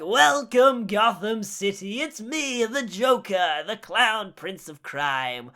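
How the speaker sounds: theatrical villain proclamation; showboating clown villain addressing the world; Joker. Acted. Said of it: "address the world on camera being like".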